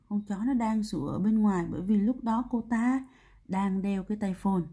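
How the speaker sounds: usual speaking voice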